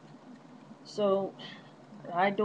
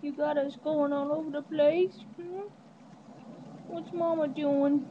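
put-on higher, childlike dog voice; voicing the dog; 6 semitones higher than usual. Owner voicing her dog talking back to her, high and cute. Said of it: "She said".

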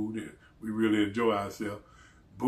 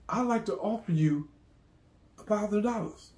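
higher-pitched mimicked stranger making an offer; 8 semitones higher than usual.

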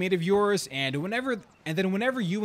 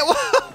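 loud high disbelieving laugh; high burst of laughter; 6 dB louder than usual. Real, not acted. Laugh after mishap.

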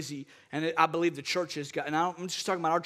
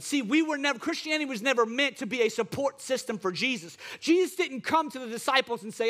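higher and fired up, preaching hard; preaching at full force; 5 semitones higher than usual. Real, not acted. Pastor to congregation, preaching at full force. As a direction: launch into the sermon's peak. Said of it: "I'm gonna preach for a second".

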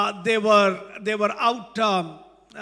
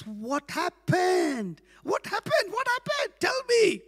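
rising higher with each ask; mock eager pastor; 10 semitones higher than usual. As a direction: pretend not to know, fishing. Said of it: "Then the pastor says".

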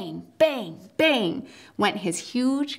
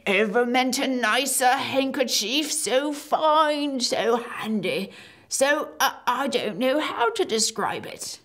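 lightly voiced humble giant, a bit higher; 3 semitones higher than usual. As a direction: play the simple, polite giant. Giant character voice.

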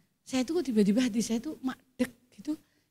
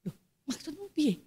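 startled reply; 2 semitones higher, 9 dB quieter than usual. Retold or imitated. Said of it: "kaget, Saudara".

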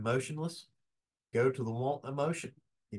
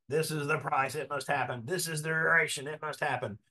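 mock honey-badger demand; 4 semitones higher than usual. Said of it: "You don't go in there honey badger".

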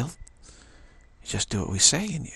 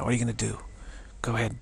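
usual speaking voice

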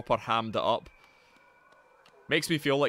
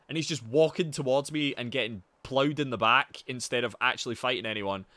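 ordinary talk, nothing marked

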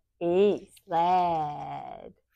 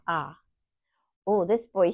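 ordinary talk, nothing marked

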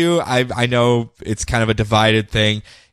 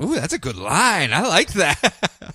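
impressed; 4 semitones higher, 3 dB quieter, slower than usual. Acted. Commenting on the writing in a voice.

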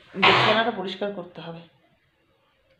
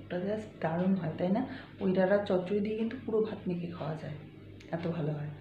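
softer, hushed talk to the viewers; speaking softly; 7 dB quieter than usual. Real, not acted. Hushed so Poko keeps sleeping, speaking softly. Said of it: "আমি আস্তে আস্তে কথা বলছি".

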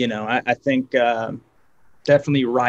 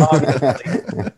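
laughing; much slower than usual. Real, not acted. Teased about favorite venue.

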